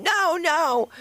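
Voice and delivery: high, mock panicked no; mock scream. Mimicking herself screaming at holy water, high mock panic. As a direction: squeal it like being burned. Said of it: "I would scream".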